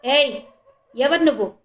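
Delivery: narrated police officer's shout; loud challenging shout. A narrator's reading. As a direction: call out loudly, demanding an answer. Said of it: "గట్టిగా అరిచాడు".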